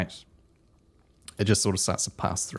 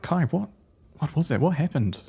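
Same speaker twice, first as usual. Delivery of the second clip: high-pitched, anxious questions; stressed concern; 9 semitones higher, 4 dB louder, faster than usual. Acted. Friend to Kai after the vision, high and worried. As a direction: fret over a friend, voice raised high. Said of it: "looking absolutely stressed right now".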